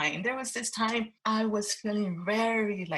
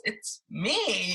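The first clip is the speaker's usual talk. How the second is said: normal talking voice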